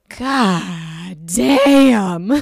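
loud, drawn-out exclamation; exclaimed amazement. Retold or imitated. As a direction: belt it out as a stunned reaction. Acts out stunned reaction to Hope.

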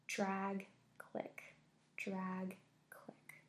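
hushed, spaced-out footstep sounds; eerie whisper. A narrator's reading. Limping woman closing in behind the girls, whispered. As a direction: whisper each word like a creeping step.

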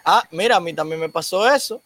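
ordinary delivery

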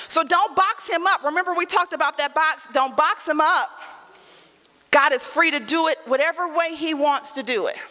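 ordinary delivery

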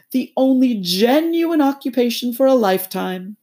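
high, loud pompous toad voice; boastful Toad. Acted. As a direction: lord it over them like a puffed-up squire. Toad lectures his friends.

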